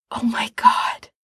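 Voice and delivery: breathless awed whisper. Acted. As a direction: whisper it in wonder. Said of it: "Frank whispers".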